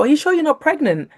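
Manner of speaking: high put-on voice of a childminder; childminder's question. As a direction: lightly voice the puzzled childminder. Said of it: "and the childminder go".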